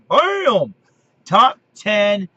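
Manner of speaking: loud high shout of boom; a big showman's boom for the reveal; excited boom. Real, not acted. Announcing his top ten list with an excited boom.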